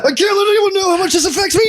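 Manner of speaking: very high, strained fake bawling; mock tearful breakdown. Grown man hiding hurt from boss, mock-crying squeal. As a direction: squeal it out through hammed-up sobs. Adult bottling up crying, acted.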